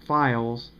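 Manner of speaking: ordinary talk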